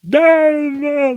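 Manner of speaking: high, loud mock yell of frustration; mock scream. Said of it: "You can scream and shout".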